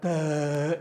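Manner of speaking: low, dull mock "duh"; lightly voiced dim child; dopey toddler "duh". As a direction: play a slow kid, dumb and low. Imitating a slow-witted toddler.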